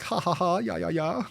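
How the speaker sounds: high, camp mock German laugh. Host voicing everyone laughing along, high mock German accent.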